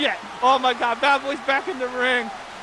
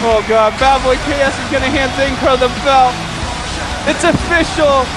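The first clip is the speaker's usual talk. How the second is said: loud ringside title proclamation; triumphant play-by-play; 3 semitones higher, 7 dB louder than usual. Real, not acted. Commentator proclaims Zane Crow the new champion, triumphant. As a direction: belt out the big moment to the whole arena. Live call of belt presentation.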